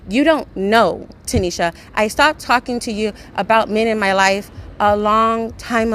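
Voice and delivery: loud, high, furious ranting at a friend; furious rant at the best friend over her stuff; angry rant. Real, not acted. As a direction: yell it out, fed up and exasperated.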